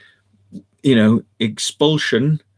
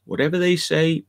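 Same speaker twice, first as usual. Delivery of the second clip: normal talking voice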